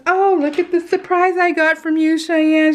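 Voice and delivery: a hint of a gushing mother-in-law; imitating mother-in-law. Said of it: "my mother-in-law goes".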